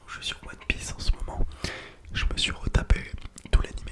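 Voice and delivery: normal talking voice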